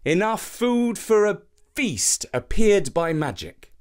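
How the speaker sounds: plain reading voice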